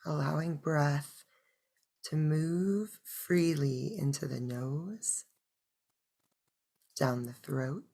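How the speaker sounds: soft, unhurried meditation voice; hushed breath-by-breath guidance; guided meditation. Acted. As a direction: guide them gently into stillness, with long pauses.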